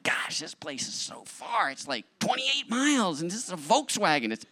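mock his own griping, pitched high; hammed-up moaning about the heat; whiny complaining. Retold complaining on the drive.

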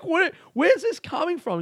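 mock shocked what-the; baffled high outburst. Friends baffled by his rant, calling out high.

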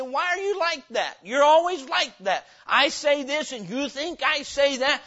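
high, loud mock accusation; acted quarrel. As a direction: act out an exasperated spat. Acted quarrel after firing off.

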